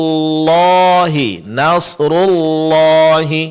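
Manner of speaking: nasrullahi, ru with pursed lips, lam with lips back, slow; slow drawn nasrullahi with rounded ru. Shown as a demonstration. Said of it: "kedua bibir dimonyongkan".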